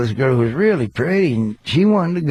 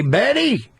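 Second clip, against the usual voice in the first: calling to Betty; 10 semitones higher than usual. Retold or imitated. Calling out to a coworker.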